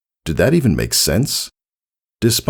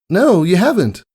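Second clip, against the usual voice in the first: high, louder filly; curious Scootaloo; 4 dB louder than usual. A narrator's reading. Voices Scootaloo.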